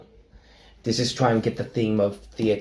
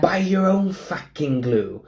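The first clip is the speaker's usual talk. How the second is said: put-on slow, higher-pitched South Wales accent; harsh South Wales accent; 7 semitones higher, slower than usual. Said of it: "it's more harsh as well".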